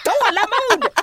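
high, loud laughing "Tålamod!"; a laughing, incredulous "Tålamod!"; laughing exclamation. Retold or imitated. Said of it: "Jag tänkte säga".

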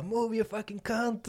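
retold shout. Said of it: "någon som bara skriker bara".

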